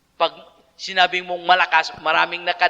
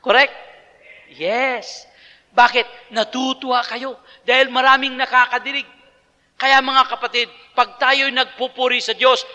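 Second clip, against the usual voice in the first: usual speaking voice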